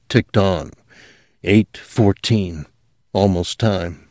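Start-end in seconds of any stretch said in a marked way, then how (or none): none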